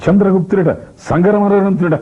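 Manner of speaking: high, scornful stage declamation; S V Ranga Rao. Acted. Actor as Chanakya scoffing at Chandragupta, high declamation. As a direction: throw the names out mockingly, pitched high. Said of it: "Rangarao గారు చాణక్యుడు వేస్తే".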